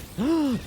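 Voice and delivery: high startled gasp; sharp gasp. Real, not acted. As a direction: gasp in sudden shock. Finds irradiated area in game.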